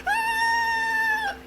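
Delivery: very high shrill-pitched fake scream; high mock scream. Vlogger imitating the lady's scream in the trailer, high mock scream. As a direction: shriek it like a horror-film victim. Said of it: "she starts screaming like".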